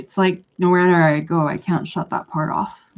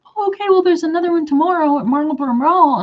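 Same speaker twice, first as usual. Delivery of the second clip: much higher, lilting quoted reply; imitating another woman; 10 semitones higher, 4 dB louder, faster than usual. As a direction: put on her high-pitched voice. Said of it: "She's like".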